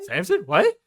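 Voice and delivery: stunned what, voice jumping up; astonished. Retold or imitated. Doctor astonished by the word.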